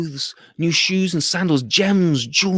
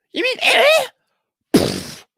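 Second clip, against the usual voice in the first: high baffled parrot question; a parrot not getting it; high confused squawk; puzzled parrot; 6 dB louder than usual. Acted.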